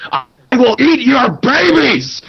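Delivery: slow, high furious yell. Retold or imitated. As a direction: scream it like a madman. Dan yelling at the bank.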